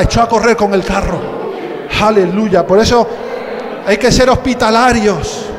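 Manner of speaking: loud, rising preaching; full-force preaching. Acted. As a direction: preach it to the congregation. (predicación a todo pulmón)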